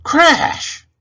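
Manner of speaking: very high, very loud crash. Acted. Narrator voicing the table collapsing, loud crash. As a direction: blast it out like the table falling.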